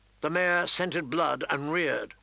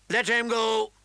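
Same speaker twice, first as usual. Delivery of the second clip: king's raised-voice order; commanding, pitched-up call; 7 semitones higher, 4 dB louder than usual. A narrator's reading. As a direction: call it out high and firm. King calls out an order.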